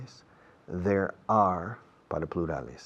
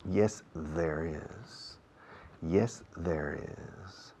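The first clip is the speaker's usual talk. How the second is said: very slow, soft "Yes, there is"; patient teacher demo; slow model answer; 3 semitones lower, 8 dB quieter, much slower than usual. Shown as a demonstration. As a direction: let each word hang. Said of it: "slow model answer".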